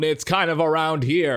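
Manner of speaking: ordinary talk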